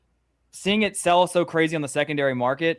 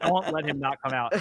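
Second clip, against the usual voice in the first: dead serious. Retold or imitated. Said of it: "he looks me in the eye dead serious".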